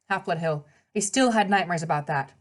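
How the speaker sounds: usual speaking voice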